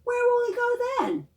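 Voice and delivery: high wondering question; curious wonder. A narrator's reading. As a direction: wonder aloud to the kids. Storytime question.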